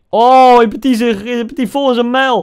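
loud, high shocked cry; shocked yell. Real, not acted. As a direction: yelp as the ball hits his face. Speler krijgt bal in gezicht. (luid en hoog geschrokken roepen)

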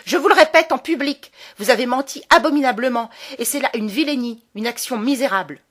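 loud, high yell, fast at its peak; shouting at full voice. A narrator's reading. Boy shouting the accusation at the baron, full shout. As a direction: drown out his mother. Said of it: "crier soudain de sa voix la plus forte".

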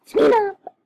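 sudden loud, high shout; impatient yell. Pretend. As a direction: yell it at a stalled screen. Impatient yelling at loading screen.

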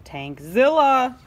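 slow dramatic roar; dramatic boom. A narrator's reading. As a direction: announce the monster. Loud reveal.

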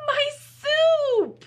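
very high, loud wail; hammed-up storybook outrage; dismayed cry. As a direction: cry out over a ruined creation. Max finds his soup poured out.

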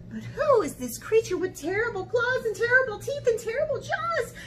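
high, rushed, breathless description; alarmed amazement. Acted. Mouse suddenly facing the monster, high fast panic. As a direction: race through it in rising high-pitched alarm.

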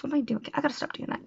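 half-whispered self-scolding aside; breathy muttered self-reproach. Real, not acted. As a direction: mutter it to yourself, almost voiceless.

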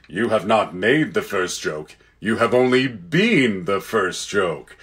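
Aslan, kindly. Acted. Lion correcting the proud little jackdaw, slow and kindly. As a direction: answer with patient gravity.

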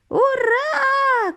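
very high, long hurrah; cheering cry. A narrator's reading. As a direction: whoop it high and stretched. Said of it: "кричав дідунь Джо".

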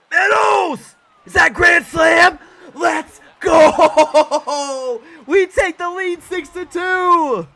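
very high, very loud victory yell with laughter; real jubilant outburst breaking into laughs; ecstatic shouting. Real, not acted. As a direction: scream it like your team just hit a grand slam. Celebrates a grand slam.

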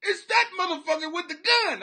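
high, loud, comic alarm; high-pitched mock panic; panicked deer. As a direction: yelp it like a spooked deer spotting the hunter. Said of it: "the deer are like".

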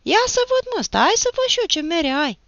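high, piqued, insistent demand; sharp, rather loud. A narrator's reading. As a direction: raise your voice, loud and stung. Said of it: "cu un glas înțepat și cam tare". (glas înțepat, tare și ascuțit)

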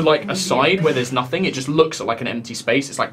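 ordinary delivery

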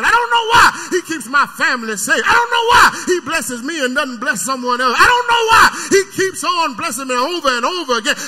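loud, crying preacher's whoop; preaching at full force. Acted. Preacher to his church about bad bread, full-force crying shout. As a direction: pour it out over the congregation.

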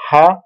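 teacher's isolated, lifted "Ha"; syllable sounded out. Shown as a demonstration.

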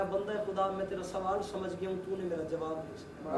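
preacher draws the crowd in gently; story sermon. Acted. Preacher retelling the imam's reply to the crowd, softly measured.

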